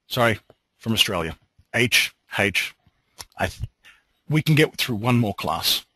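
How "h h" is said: H said as haitch, Australian style, twice; Australian "haitch" spoken twice, slightly self-mocking; Australian "H" repeated. Shown as a demonstration. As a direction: say "H, H" the Australian way, with an h sound, wryly. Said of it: "people are having a go at my accent".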